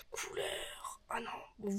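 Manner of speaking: soft unvoiced whisper. Real, not acted. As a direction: whisper it close to the mic. Whispered aside.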